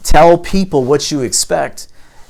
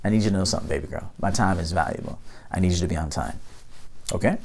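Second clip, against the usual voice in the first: softer, lower, steady firm talk; calm firm talk to a late date; 5 semitones lower, 9 dB quieter, much faster than usual. Pretend. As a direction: set the boundary without heat. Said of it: "Notice I wasn't emotional".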